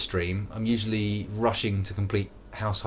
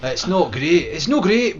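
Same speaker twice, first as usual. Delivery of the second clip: put-on higher, louder Scottish accent; 7 semitones higher, 7 dB louder than usual. Streamer trying a Scottish accent on chat, Scottish. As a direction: lay on the Scottish burr. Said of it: "my Scottish accent".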